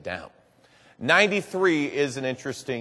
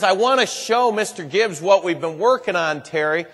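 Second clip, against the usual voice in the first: coaxing mother; 4 semitones higher, 6 dB louder than usual. Retold or imitated.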